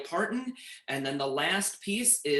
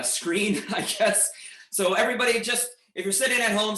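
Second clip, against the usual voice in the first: normal talking voice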